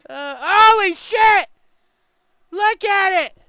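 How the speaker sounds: loud, slow stunned cry; shocked exclamation. Real, not acted. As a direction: blurt it out in shock. Shocked at what they see.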